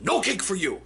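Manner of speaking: high, barked no cake order; soup Nazi. Retold or imitated. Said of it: "my best Seinfeld soup Nazi voice".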